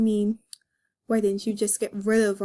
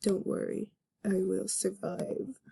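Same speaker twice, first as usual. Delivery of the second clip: soft, slow resigned goodbye; quiet farewell; 9 dB quieter than usual. Acted. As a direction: murmur it as you leave. Nick leaving, resigned.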